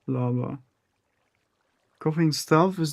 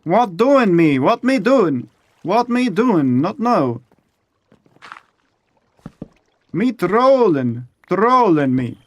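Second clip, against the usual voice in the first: higher, louder goofy voice, hammed-up; mock confused broken English; 6 semitones higher, 7 dB louder than usual. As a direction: play a befuddled simpleton talking to himself.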